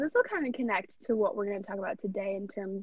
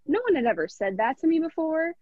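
normal talking voice

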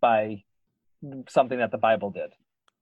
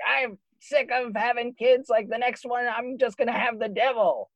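put-on old lady voice; 10 semitones higher than usual. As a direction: play a fed-up old lady. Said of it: "some old lady ... was like".

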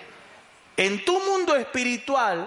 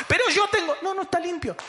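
devil's voice; 9 semitones higher, faster than usual. Acted. Devil arguing with God.

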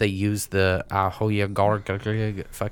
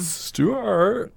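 Stuart impression; 11 semitones higher than usual. Character name called out in voice.

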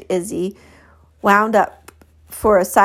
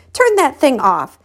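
higher, indignant command; angry order; 6 semitones higher, 4 dB louder than usual. Acted. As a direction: order it off in exasperation. Carlotta angrily orders camera off.

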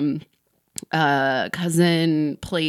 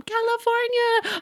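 high, drawn-out yell from the crowd; fan shouting answer; slower than usual. Retold or imitated. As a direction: shout your state from the back.

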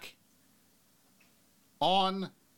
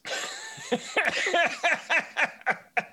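high burst of laughter. Real, not acted. Reacting to chat quote.

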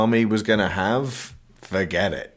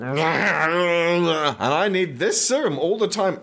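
slow, much higher pompous voice; mock Jekyll; 8 semitones higher, slower than usual. Mocking Russell Crowe revealing his name, slow pompous high. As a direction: announce it grandly and slowly. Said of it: "then he's like".

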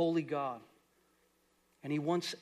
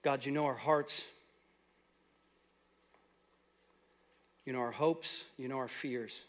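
softer, lower prayer voice; closing prayer; 4 semitones lower, 3 dB quieter than usual. Acted. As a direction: pray quietly for the church.

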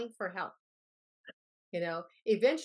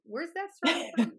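high, loud puzzled asking; surprised question; 10 semitones higher, 7 dB louder than usual. Retold or imitated. People asking where the story is from, surprised and curious. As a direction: ask it like you've never heard of it.

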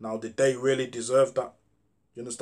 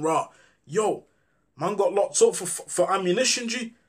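mildly voiced mate, higher and a bit pushed; relaxed street slang, lightly acted; friends' street voice; 6 semitones higher than usual. Friend on the phone, released from the station, in a lightly acted street voice.

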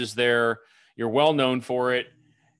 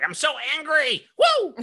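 high-pitched mock tantrum with a loud whoop; mock crazy anger; 4 dB louder than usual. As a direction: act out an over-the-top angry outburst. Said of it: "crazy person reaction of like".

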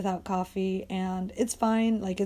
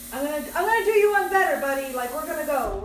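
high, quick promise to a pet; talking to dog; 6 semitones higher, 3 dB louder, faster than usual. Real, not acted. Owner promising dog an outing, high quick voice. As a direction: pitch it up and hurry it along.

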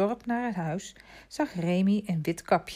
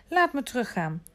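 higher-pitched plea; pleading; 7 semitones higher than usual. A narrator's reading. Remy asks Vitalis to let him go back, pleading. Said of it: "smeekte hij".

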